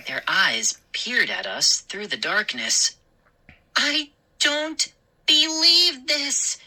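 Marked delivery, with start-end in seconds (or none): 3.7-6.6 slow, high incredulous cry; can't believe it; disbelieving cry. A narrator's reading. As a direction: sister stunned by the gnomes. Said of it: "Mindy cried".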